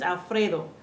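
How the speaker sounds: normal talking voice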